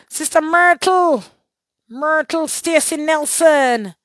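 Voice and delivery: high, loud, drawn-out name call. Real, not acted. Host greets a livestream viewer by name, calling out.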